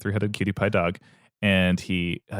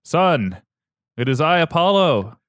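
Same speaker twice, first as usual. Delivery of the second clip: higher and louder, booming god announcing himself; mock-grand deity entrance; grand Apollo voice; 8 semitones higher, 6 dB louder than usual. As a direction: proclaim it like a divine father descending. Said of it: "He's like".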